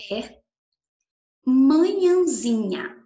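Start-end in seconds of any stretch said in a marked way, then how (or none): 1.4-3.0 slow, drawn-out and louder word; over enunciating. Shown as a demonstration. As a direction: stretch each syllable clearly. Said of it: "Vou falar bem pausadamente".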